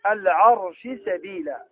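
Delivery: "العرش سبيلا" slow, the sheen kept clear before the seen; إظهار الشين. Shown as a demonstration. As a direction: read العرش سبيلا slowly with a distinct sheen. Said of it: "في قوله تعالى". (إظهار الشين قبل السين بتأنٍّ)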